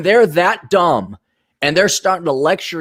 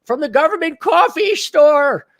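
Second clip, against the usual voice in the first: mock Southern accent; 13 semitones higher, 4 dB louder than usual. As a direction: put on a silly Southern old-timer voice. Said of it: "Southern accents".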